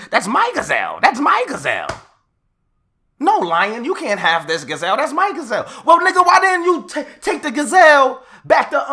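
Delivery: loud, high-pitched rant the whole way; worked-up shouting rant; ranting yell. Real, not acted. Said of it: "why was you screaming".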